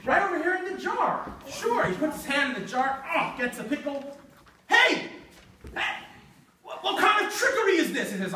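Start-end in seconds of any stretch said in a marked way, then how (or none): 4.7-8.1 startled goblin. Acted. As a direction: yelp in alarm at the trap. Goblin's hand stuck in jar.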